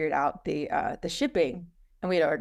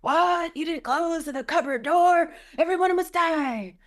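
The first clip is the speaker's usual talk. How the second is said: hammed-up furious shriek, much higher and louder; mock rage; 11 semitones higher, 5 dB louder than usual. Mother raging at family over cupboard door, hammed-up shriek. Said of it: "I'm like".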